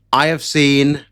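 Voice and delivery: ordinary talk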